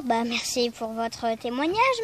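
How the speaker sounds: normal talking voice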